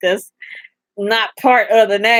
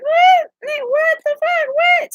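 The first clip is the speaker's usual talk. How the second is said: shocked disbelief. Retold or imitated. Retelling her reaction to the comedian, high-pitched outraged disbelief. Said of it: "I'm like".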